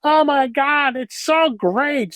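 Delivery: high-pitched, drawn-out mock amazement; exaggerated American gushing. Dutch guest imitating an American thrilled by Dutch customs, exaggerated gushing. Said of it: "it's all exaggerated".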